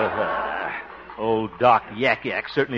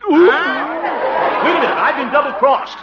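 high, loud cry of betrayal; outraged protest; 14 semitones higher, 9 dB louder, slower than usual. Acted. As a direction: burst out indignantly, pitched way up. Outraged at rival's surprise self-nomination.

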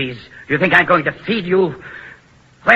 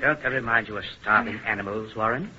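softer, quick, mocking aside; sneering aside; 6 semitones lower, 9 dB quieter, faster than usual. Jailer sneers to his assistant about the prisoners, softly. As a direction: share a cruel joke under your breath.

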